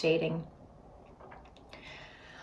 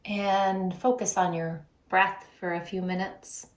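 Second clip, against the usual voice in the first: guided breathing meditation. Real, not acted. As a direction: lead them unhurriedly through the breath, leaving space between phrases.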